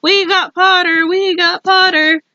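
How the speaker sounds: slow, loud, high triumphant chant; twins yelling. A narrator's reading. Weasley twins cheering over their new housemate, hollering. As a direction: holler it across the table. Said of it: "twins yelled".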